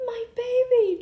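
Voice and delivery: high, very loud mock wail; screaming. Shoplifter wailing over her broken watermelon, acted scream. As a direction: scream it out, hammed-up and anguished. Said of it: "she started screaming".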